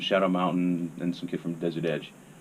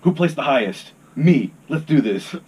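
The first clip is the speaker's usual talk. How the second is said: loud, higher, cocky boast of the winner; bossy insistence; 5 semitones higher, 10 dB louder than usual. Retold or imitated. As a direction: claim the win out loud, cocky. Insisting on IHOP over Denny's.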